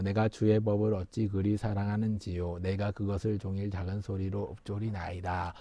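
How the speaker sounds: very soft, low recitation; scripture read low and hushed; low murmured reading. Shown as a demonstration. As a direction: murmur the verse under your breath. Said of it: "소리를 내서 읽는 거예요".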